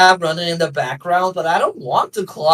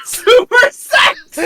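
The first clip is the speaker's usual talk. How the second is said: laughing shout; 5 dB louder, much slower than usual. Real, not acted. Laughing nonsense outburst.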